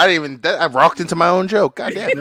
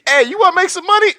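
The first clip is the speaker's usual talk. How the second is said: high, loud, fast hustler voice cracking; hustler pitch; 13 semitones higher, 6 dB louder, faster than usual. Pretend. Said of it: "seventies car salesman outfit".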